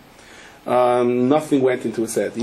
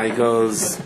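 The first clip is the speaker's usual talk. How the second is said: ordinary talk, nothing marked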